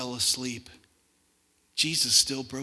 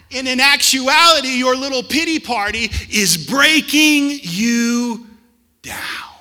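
much louder, higher, slow preaching; full-force preaching; 9 semitones higher, 13 dB louder than usual. Real, not acted. Pastor warns church pity breaks them, loud preaching. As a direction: drive it home from the pulpit.

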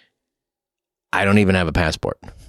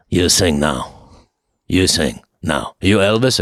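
low, slow, halting gangster boss voice, lightly voiced; a stern little mobster ordering him to sing; funny voice of the mob boss; 6 semitones lower, slower than usual. The boss telling the new [name] to sing now, in a low, slow, lightly put-on voice. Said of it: "He's got, he's got a funny voice too".

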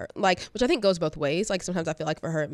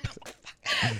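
sudden high laugh; a short high laugh; burst of laughter; 4 dB quieter than usual. Real, not acted. Laughing at the punchline.

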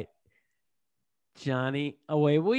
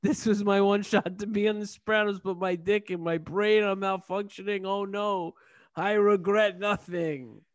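hammed-up, higher-pitched dying wail; mock stroke; 5 semitones higher than usual. Character collapsing in his one shot on the show, mock-dying voice. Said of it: "I'm having a stroke".

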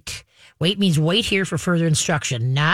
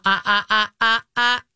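higher, rapid-fire warning ah-ahs; corrective "ah-ah-ah"; 5 semitones higher than usual. Shown as a demonstration. Trainer stops dog racing past, higher warning ahs. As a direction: cut the dog off with repeated ahs.

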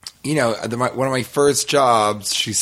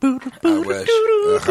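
high squeal; much slower than usual. Pretend. Mock reacting to the jingle.

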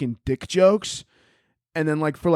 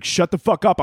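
higher-pitched irritated retort; touch of mock anger, pitched up; irritated outburst; 6 semitones higher than usual. Adderall takes over from the emotions, irritated outburst. Bossy pill snaps at emotions.

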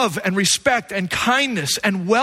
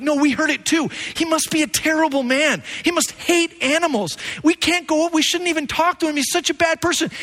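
put-on lightly higher kid voice; kids' chatter; 6 semitones higher than usual. Kids at breakfast judging the neighbor, lightly higher voice. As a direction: voice the shocked kids. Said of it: "all the kids start talking and they're like".

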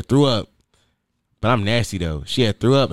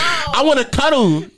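high, slow pleading; whiny cuddle plea; 10 semitones higher, slower than usual. Pretend. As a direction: beg for a cuddle like a child. Pretend needy cuddler.

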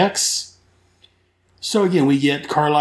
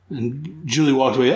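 ordinary talk, nothing marked

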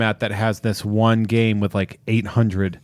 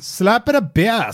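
higher, slow silly voice; goofy bit; 10 semitones higher, slower than usual. Pretend. Cohost riffing a silly catchphrase, slow high voice. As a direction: say it like a cheesy catchphrase.

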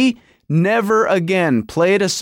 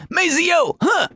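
high funk-singer call with a grunt; 11 semitones higher, slower than usual. Retold or imitated. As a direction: cue the sax player with a sharp, high cry.

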